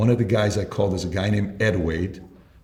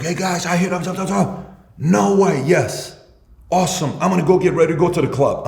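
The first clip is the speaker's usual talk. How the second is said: hyped-up coworkers; 7 semitones higher than usual. Retold or imitated. Salesmen cheering after hitting targets.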